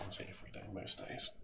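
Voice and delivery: whispered confession, under the breath; sneaky whispered admission; whispered aside. Real, not acted. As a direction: let it slip quietly. Confiding aside.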